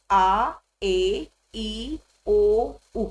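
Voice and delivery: आ, ए, ई, ओ, ऊ as slow separate "ah, eh, ee, oh, oo"; German vowel names. Shown as a demonstration. Teacher reciting vowels for beginners, आ, ए, ई, ओ, ऊ slowly separated. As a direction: draw out each vowel आ, ए, ई, ओ, ऊ on its own. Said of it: "so A, E, I, O, U is".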